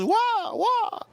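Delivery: very high squeaky imitation of father; father's squeak. Imitating father confused at sushi place, squeaky. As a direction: mimic dad in a tiny high squeal. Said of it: "He goes".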